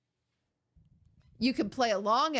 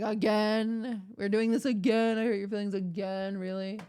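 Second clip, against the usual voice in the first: mock exasperation; 3 semitones lower than usual. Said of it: "And I'm like".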